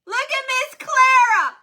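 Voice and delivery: high, loud excited greeting; light up at seeing her name; excited call. Real, not acted.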